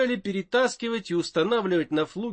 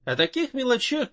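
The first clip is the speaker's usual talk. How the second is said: ordinary delivery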